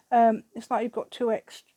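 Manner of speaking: getting carried away with delight; excited, carried away. Real, not acted. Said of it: "I'm getting carried away now".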